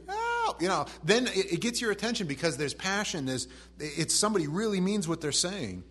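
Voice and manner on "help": high, loud desperate help; real-sounding scream for help. Retold or imitated. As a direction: scream it like you're drowning. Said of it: "somebody screaming".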